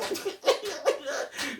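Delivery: breathy high laugh cutting in. Real, not acted. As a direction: laugh high between lines. Laughs mid-punchline.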